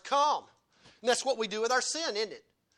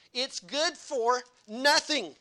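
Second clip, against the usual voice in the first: slow emphatic; 5 semitones higher, 5 dB louder, much slower than usual. Acted. Preacher drives home the point.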